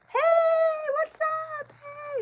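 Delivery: very high, sing-song fake perky hello; fake cheerful greeting. Faking happiness on camera for staring people, high chirpy hello. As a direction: put on a chirpy mask of happiness. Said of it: "You wanna look all happy".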